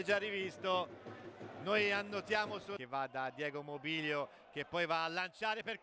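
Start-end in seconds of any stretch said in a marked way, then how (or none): none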